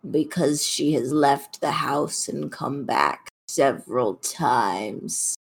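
slow, measured complaint from an offended cat; aggrieved talking cat. Acted. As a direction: lodge a solemn grievance as the cat.

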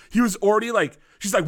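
normal talking voice

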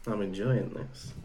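low, slow, soft aside; demonstrated aside. Shown as a demonstration. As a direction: murmur it slowly to the room. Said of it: "you just step back and you go".

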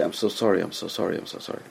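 low, soft condolence fading away; hushed condolence. Retold or imitated. Visitor consoling someone at a house of mourning, hushed condolence. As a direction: murmur sympathy, sinking to almost nothing.

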